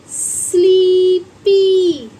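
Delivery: स्लीपी stretched slow and loud; drawn-out demo word. Shown as a demonstration. Teacher to pupils, slow loud stretched demo. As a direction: sound out स्लीपी long and loud, stressing the ee. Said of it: "S L double E P Y".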